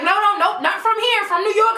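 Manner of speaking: high-pitched, insistent disclaimer; retold anxious protest; hasty denial. Hastily denying to the cop, retold.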